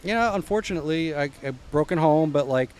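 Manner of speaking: ordinary delivery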